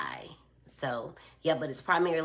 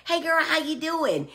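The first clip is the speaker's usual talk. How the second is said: high, bright passing hello; breezy greeting; 12 semitones higher, 5 dB louder than usual. Retold or imitated. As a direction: call out a quick casual hi. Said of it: "when we say".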